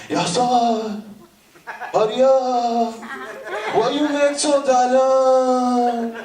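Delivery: high, drawn-out nagging complaint; cameraman with attitude. Acted. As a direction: stretch each phrase out in mock annoyance. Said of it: "the cameraman started to have an attitude".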